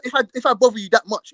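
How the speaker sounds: ordinary delivery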